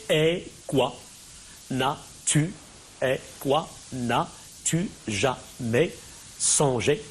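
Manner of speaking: "et quoi? N'as-tu jamais songé" one syllable at a time, gaps between; divided into syllables. Shown as a demonstration. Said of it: "on divise les vers ici en syllabes".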